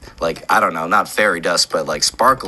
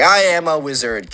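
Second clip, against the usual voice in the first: raised, louder, offended protest; indignant wizard kid; 6 semitones higher, 6 dB louder than usual. Retold or imitated. Wizard kid snaps back at Jacob, indignant and louder. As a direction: insist hotly like a mocked kid.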